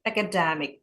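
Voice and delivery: academic as a-ca-DE-mic; academic, weight on its third syllable; stress on third syllable. Shown as a demonstration. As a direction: punch the DE of academic. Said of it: "akademik itu di suku kata ketiga".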